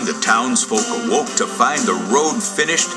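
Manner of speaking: ordinary delivery, nothing marked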